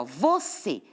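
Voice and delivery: "você" with CÊ leaned on; teacher showing "vo-CÊ"; stress on last syllable. Shown as a demonstration. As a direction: sound out "você", strong final CÊ. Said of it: "a sílaba mais forte é a última".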